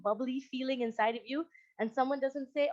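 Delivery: ordinary talk, nothing marked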